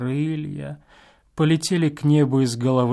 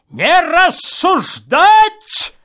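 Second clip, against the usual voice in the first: put-on slow, loud, higher old man's command; 10 dB louder, much slower than usual. A narrator's reading. Old man behind the wall silences the guest, stern. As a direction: order like a cranky old man. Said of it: "старческий охрипший голос".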